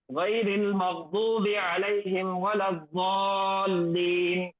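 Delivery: slow melodic recitation of the verse; Quran recitation. Shown as a demonstration. Teacher reciting the full ayah for students, slow and melodic. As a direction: draw out the madd vowels as you recite. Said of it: "ये है इसका पढ़ने का तरीका".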